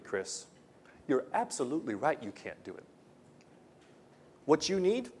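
normal talking voice

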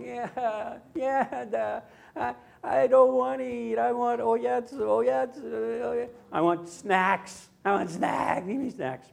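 put-on high whining little girl voice; whiny toddler. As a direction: whine like a stubborn toddler. Granddaughter whining, refusing food.

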